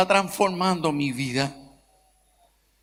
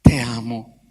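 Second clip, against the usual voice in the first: tender father; 9 semitones lower, 4 dB louder than usual. Retold or imitated. Father finally says he loves son.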